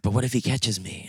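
whispered imitation of a nervous accomplice; hushed, voiceless worry; worried whisper. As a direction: whisper it, wary of getting caught. Friend worried about being caught.

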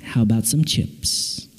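low, soft, soothing voice; God's gentle offer. Acted. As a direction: offer it quietly, deep and calm. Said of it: "God shows up and says".

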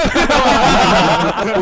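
loud, high belly laugh; burst of laughter. Real, not acted. Co-host bursts out at the joke about living off looks, loud laughter.

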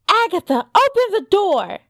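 high-pitched, urgent command; alarmed. A narrator's reading. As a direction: demand entry before the cat attacks. Cat menaces Sophie at the door.

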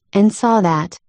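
usual speaking voice

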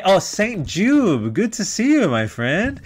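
high, loud, drawn-out hail; delighted greeting. Real, not acted. Greeting a friend in the chat.

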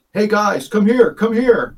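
imitating an urgent call, pitched well up. Friend summoning the group across a cemetery, calling out.

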